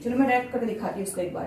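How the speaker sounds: ordinary delivery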